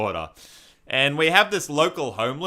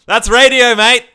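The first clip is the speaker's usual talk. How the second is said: excited; 10 semitones higher, 13 dB louder than usual. Real, not acted. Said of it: "A M or F M?".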